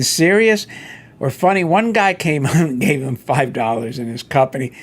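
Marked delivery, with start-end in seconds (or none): none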